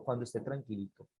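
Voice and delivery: low, hushed and quick; soft repeat. Shown as a demonstration. Preacher repeats advice to parents, low and soft. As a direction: drop it down to a calm murmur. Repeats condition much softer and lower.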